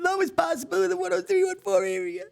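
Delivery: mock the cheapskate neighbor begging online; whiny Staten Island neighbor. Chef mocking Staten Island neighbors hunting cheap heart pizza, high whiny voice. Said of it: "Staten Island neighbors is".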